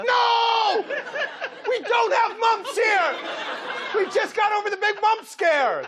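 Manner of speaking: high, loud official protesting; travel official. Pretend. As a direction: shout it like an offended tourism official. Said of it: "somebody from the Liberian travel board like".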